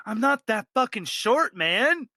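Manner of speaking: offended dwarf killer. Acted. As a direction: huff like a touchy short guy.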